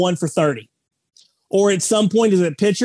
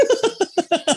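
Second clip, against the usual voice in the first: high laugh; 13 semitones higher than usual. Real, not acted. Laughing at his own joke.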